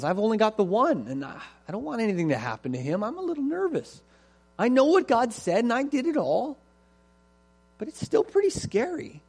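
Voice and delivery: high, worried voice, a bit defensive; first Hebrew admits his fear to his friend, high and anxious; nervous Hebrew. Acted. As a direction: fret aloud about your only son. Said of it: "Well, yeah".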